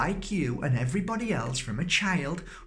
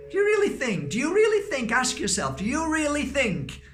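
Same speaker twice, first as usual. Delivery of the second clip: high, louder insistent question; emphatic raised voice; 12 semitones higher, 4 dB louder, faster than usual. Real, not acted. Speaker urging the audience, high and insistent. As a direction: press them hard to really think.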